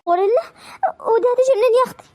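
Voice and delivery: high, fast stunned disbelief; mock shock. دهشة من حكاية الغوريلا. (دهشة عالية وسريعة مستنكرة)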